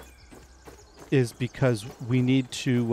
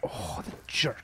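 whispered; 4 semitones lower, 8 dB quieter, slower than usual. Real, not acted. Whispered reaction.

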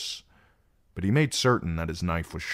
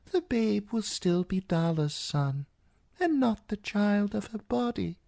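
put-on high, frail old man, gentle and slow; old maester's voice. A narrator's reading. Old maester gently explaining grief to Sam, frail old voice. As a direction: speak as the ancient blind maester, gently.

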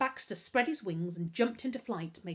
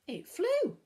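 high, louder burst of astonishment; amazed; 13 semitones higher, 6 dB louder than usual. A narrator's reading. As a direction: marvel at what you just saw.

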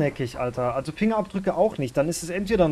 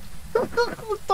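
relieved laugh; 5 dB quieter than usual. Real, not acted. Relieved laugh after escape.

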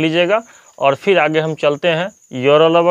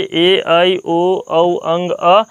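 slow letter recital; much slower than usual. Shown as a demonstration. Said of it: "इसके first method से देख लीजिए".

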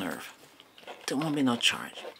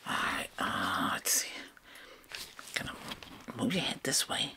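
whispering; 5 dB quieter than usual. Acted. ASMR roleplay whispering.

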